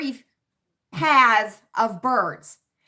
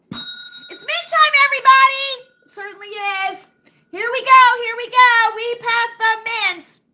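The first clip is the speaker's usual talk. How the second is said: mock announcement; 10 semitones higher, 6 dB louder than usual. Mint bowl announcement segment.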